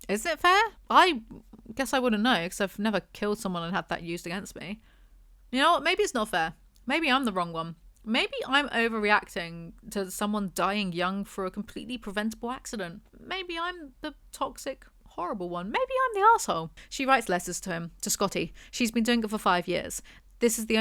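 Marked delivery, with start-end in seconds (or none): 0.1-3.4 high, loud incredulous snap; outraged retort. Real, not acted. Reviewer scoffing at the hero's words, outraged. 13.2-16.7 high, sing-song mocking sarcasm; mock the self-pity, pitch rising; sarcastic mockery. Real, not acted. Reviewer sarcastically mocking Kenna's self-pity.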